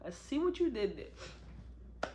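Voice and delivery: soft, slow knowing tease; sly hushed tease. Retold or imitated. Narrator reacting to Khalil's line, soft knowing tease. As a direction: murmur it like you caught the pun. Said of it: "I was like".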